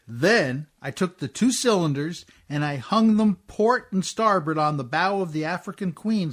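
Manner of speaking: normal talking voice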